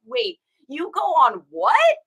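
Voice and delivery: slow, incredulous mock question; imitated disbelief. Offline best friend learns about TikTok, slow mock disbelief. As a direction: stretch it out, leaping up on the last word.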